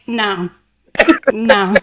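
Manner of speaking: imitating a deadpan no; short, unamused no, mimicking him; straight-faced refusal. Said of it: "Devontae's straight face is like".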